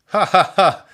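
high loud giggle; gleeful boy laugh; delighted laugh. A narrator's reading. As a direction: laugh at the carrot toes. Laughs at toes.

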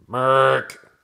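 loud, high ostrich honk; sudden loud throaty squawk; trumpeting creature squawk. A narrator's reading. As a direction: blast out an awful bird cry. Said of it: "The awfulest sound trumpeted out".

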